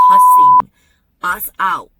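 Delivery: sudden shout on cussing; outraged retelling. Retold or imitated. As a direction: blurt the word out loud. Angry retelling of brother-in-law.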